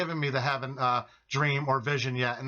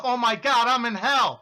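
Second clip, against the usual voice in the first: higher, louder retold alarm; re-enacted panic, pitch raised; horrified realisation; 8 semitones higher, 6 dB louder than usual. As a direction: cry it out as the dread hits you.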